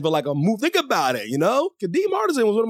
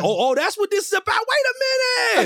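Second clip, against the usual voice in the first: higher, imitating a friend's gotcha; mock realization; 13 semitones higher, faster than usual. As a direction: act out the friend catching on. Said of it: "all I know is".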